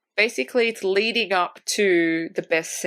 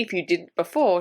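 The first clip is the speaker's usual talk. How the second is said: ordinary talk, nothing marked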